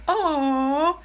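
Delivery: high, loud cooing "oh"; gushing mom. Acted. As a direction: coo like a mom handed a child's gift. Mom receiving child's ball of mud.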